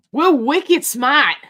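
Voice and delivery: put-on slow, loud Boston accent with dropped r on smart; hammed-up Boston "We're wicked smart", wicked stretched out and smart said smah. For Vanessa from Boston, a slow loud Boston accent. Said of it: "Vanessa is from the Boston area".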